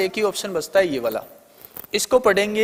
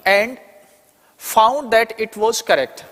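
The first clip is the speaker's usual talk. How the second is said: slow, careful reading for the class; slow dictation; much slower than usual. Shown as a demonstration. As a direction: read out very slowly, word by word. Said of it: "इसमें आपको question दे रखा है".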